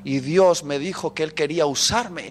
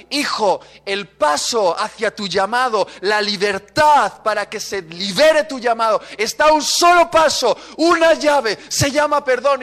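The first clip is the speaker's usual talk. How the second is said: building to a loud, high proclamation; preacher voicing the Spirit; urgent exhortation; 6 semitones higher, 6 dB louder than usual. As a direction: urge with mounting force, phrase by phrase.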